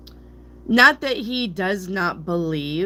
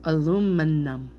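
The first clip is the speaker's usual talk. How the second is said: slowed down. Shown as a demonstration. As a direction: draw out each syllable of "Aluminum". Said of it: "saying it too fast".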